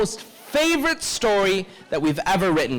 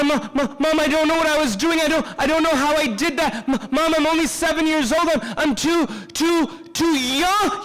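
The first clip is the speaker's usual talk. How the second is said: put-on stammering, frantic little-kid apology; seven-year-old pleading for mercy; pleading child; 3 semitones higher than usual. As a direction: beg mom in a rushed, stuttering voice. Child pleading with mom to be forgiven.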